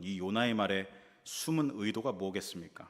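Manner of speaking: ordinary talk, nothing marked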